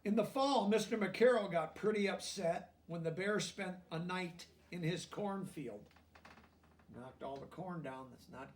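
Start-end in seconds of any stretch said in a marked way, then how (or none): 0.0-5.8 lively storybook reading aloud; reading to children. A narrator's reading. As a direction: read it out to the class, bright and clear.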